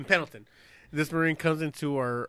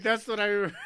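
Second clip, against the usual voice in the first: ordinary talk, nothing marked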